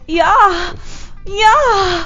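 high, loud mock-ecstatic Minnesota accent; Minnesota coming. Co-host playing along with the sound bite gag, Minnesota accent. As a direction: moan it in a hammed-up Minnesota accent. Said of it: "Minnesota coming".

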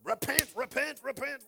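mocking the old-school repent sermon; mock fire and brimstone. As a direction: ham up the hellfire preacher. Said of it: "It's an old church word".

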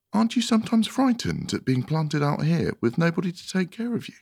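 put-on high-pitched little girl's question; high, gentle wondering; Alice, concerned questioning. A narrator's reading. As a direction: ask in a high, curious character voice.

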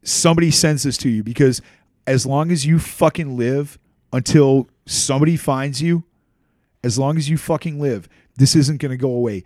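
furious threat. Real, not acted. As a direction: threaten him with rage. Addressing Mike directly, enraged.

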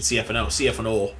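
C F N O with the final O held long; boss's long Canadian O on C F N O. Retold or imitated. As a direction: round out the O in C F N O. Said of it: "You don't do the long O".

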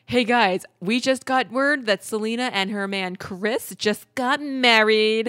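loud, drawn-out DJ delivery, landing big on the end; radio announcer. Retold or imitated. Radio host announcing a star's secret wedding, slow and punched. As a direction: imitate a radio host breaking big news. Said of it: "all of a sudden on the radio".

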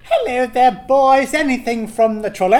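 trolley lady. Acted.

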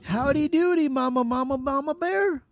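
high-pitched, hammed-up howdy; playful greeting. Host greeting Mama Bear on the panel, playfully high. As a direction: sing it out high as a silly hello. Greets guest after her prompting.

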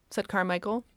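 ordinary delivery

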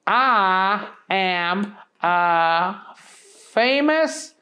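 high, very slow goofy bumpkin; goofy country voice. A narrator's reading. Booger introduces himself, high and very slow. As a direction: stretch it out like a simple country boy.

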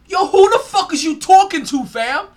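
loud, high confrontational yell; angry yell. Retold or imitated. Imitating a player confronting a fan, yelling angrily. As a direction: bark it at a heckler in the stands. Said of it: "I'm like".

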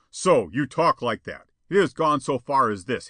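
loud, heavy rebuke; stung anger. A narrator's reading. Spawn leaps up at Jetta's refusal, angry. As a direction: come back hard and loud.

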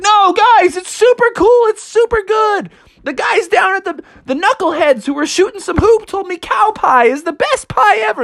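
insist, wide-eyed, it's great; pleading Froggy. Acted. Froggy begging his family to eat the pie, high and insistent.

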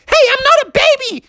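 put-on very high squeaky little-kid protest; indignant Junior. Junior objecting to being called baby, squeaky indignation. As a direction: squeal it fast, outraged. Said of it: "And Junior was like".

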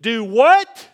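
loud, high, incredulous question; astonished outburst. Acted. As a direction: blurt it out in amazement. Feigns astonishment at the verse.

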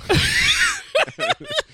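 a sudden shrieking laugh, way up high. Real, not acted. Friend's silly impression makes her shriek with laughter.

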